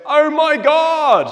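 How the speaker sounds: high, loud, slow terrified cry; man screaming at the bear, high and loud; shouted out loud. Acted. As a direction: yell it in panic as the bear strikes. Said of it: "out loud".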